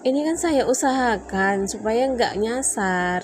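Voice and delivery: slow heated retold plea; retold explanation. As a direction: spell it out to him slowly. Retold argument.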